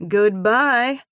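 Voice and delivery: annoyed huff; huffy goodbye. A narrator's reading. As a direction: huff it out and hang up. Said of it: "I huff as I click over".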